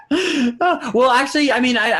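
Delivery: high giggly words through a laugh. Real, not acted. Laughing at joke.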